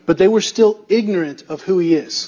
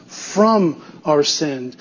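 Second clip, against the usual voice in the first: slow, low, weighty FROM; stress on FROM; 6 semitones lower, much slower than usual. Shown as a demonstration. Preacher stating what Jesus saves from, stress on FROM. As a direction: set FROM apart and stretch it. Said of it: "That preposition means everything".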